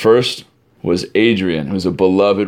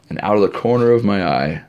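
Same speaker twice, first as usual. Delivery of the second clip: plain reading voice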